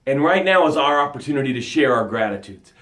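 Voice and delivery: ordinary delivery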